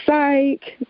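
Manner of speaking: high, drawn-out playful "psych"; joking middle-school "psych". Shown as a demonstration. Schoolkid taking back a joke, teasing. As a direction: toss it out as a teasing gotcha. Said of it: "and then you say".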